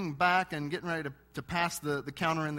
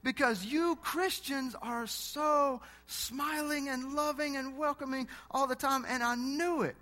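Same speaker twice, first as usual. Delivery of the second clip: high, eager voice of the cashier; light up with high excitement; excited cashier; 8 semitones higher than usual. Retold or imitated. Cashier Jonas exclaiming to the preacher, high and excited. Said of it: "He said".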